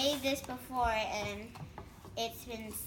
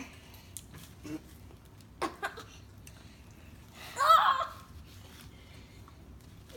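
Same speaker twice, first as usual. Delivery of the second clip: shrieking laugh; 13 semitones higher than usual. Real, not acted. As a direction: burst out laughing at the sour taste. After tasting sour slime.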